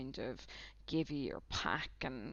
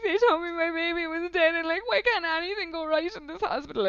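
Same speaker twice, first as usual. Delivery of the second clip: much higher and louder, imitating crying down the phone; mock tearful wail; 13 semitones higher, 10 dB louder than usual. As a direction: retell it as a loud, high-pitched tearful outburst. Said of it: "through the tears".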